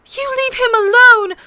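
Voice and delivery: high, loud furious scream. A narrator's reading. Sansa screams at her sister. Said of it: "Sansa screamed at her sister".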